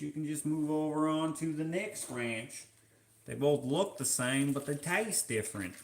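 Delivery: playful, amateur Louisiana twang; Louisiana accent. Pretend. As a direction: talk like a local in a mock Louisiana accent. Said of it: "Is that close?".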